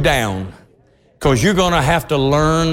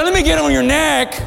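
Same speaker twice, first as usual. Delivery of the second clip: put-on high-pitched child's request; pleading little girl; 10 semitones higher than usual. Girl asking to climb higher on adult, pleading. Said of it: "she said".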